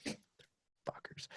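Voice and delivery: a bitter breathless whisper; jealous whispered curse; whispered insult. Real, not acted. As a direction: hiss it under your breath. Whispered insult at handsome men.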